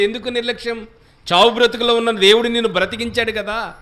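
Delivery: fervent preaching. Acted. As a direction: plead with the listeners, pressing hard.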